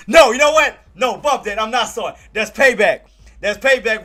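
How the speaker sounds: loud, high, playful outburst; mock-outraged and emphatic; mock indignant. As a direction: take it back with hammed-up defiance. Mock retraction of the apology.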